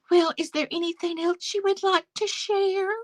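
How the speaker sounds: high, put-on fake voice; mocking Sarah's disguised voice. Imitating Sarah's disguised panel voice, high and fake. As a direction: pitch it up like a phony disguise. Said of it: "disguising her voice".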